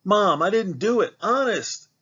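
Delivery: put-on boy protesting to his mother; pleading boy. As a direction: plead innocence, loud and high. Jared accused by mother.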